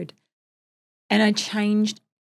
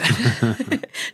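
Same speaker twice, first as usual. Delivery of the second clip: low burst of laughter; real laugh; 7 semitones lower, 6 dB louder than usual. Real, not acted. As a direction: laugh at your own joke. Laughing at her own joke.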